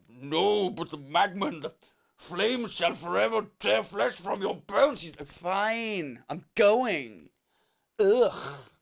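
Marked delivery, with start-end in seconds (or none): 0.1-5.0 hammed-up demonic villain voice; Satan. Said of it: "Satan's there like". 5.3-8.7 grudging mock-exasperated surrender; imitated angry huff. Annoyed woman gives in to Satan.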